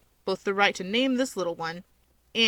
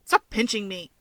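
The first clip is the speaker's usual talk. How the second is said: ordinary delivery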